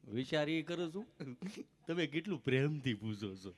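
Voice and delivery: low, halting stammer; imitating a tongue-tied man; stammering friend. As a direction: stick on the words, tongue catching. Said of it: "જીભ જલાય, ચોંટે અટકે".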